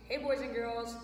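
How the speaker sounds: ordinary talk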